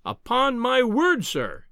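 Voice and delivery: high, slow, indignant exclamation; angry cry. A narrator's reading. Visitor offended by the professor's doubt, crying out angrily. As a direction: draw it out in affront, dropping on the last word.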